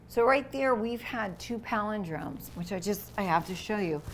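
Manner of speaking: ordinary delivery, nothing marked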